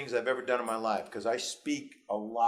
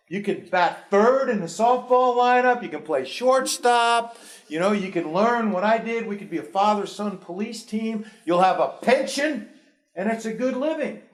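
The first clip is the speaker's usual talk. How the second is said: imitating blustering father; 10 semitones higher, 9 dB louder than usual. Father scoffing at son's wish to teach, loud mock bluster.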